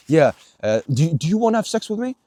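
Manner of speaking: mock a stiff, overly polite request; mock awkward asker. Vlogger mocks asking for consent, hammed-up and high. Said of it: "nobody's out here fucking".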